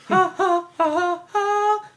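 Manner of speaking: string of high-pitched "ha" cries; mock martial-arts hero yelps; imitated hero cries. Imitating hero's action cries.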